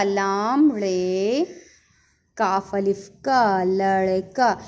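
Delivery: drawn-out letter names, low and even; spelling out slowly. Shown as a demonstration. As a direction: stretch each letter, then join them into लड़का. Teacher spells the word while writing. (धीरे-धीरे खींचकर हिज्जे करते हुए)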